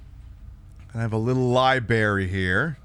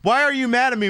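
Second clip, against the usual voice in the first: baffled whine; 10 semitones higher, 4 dB louder, faster than usual. Retold or imitated. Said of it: "And you're like".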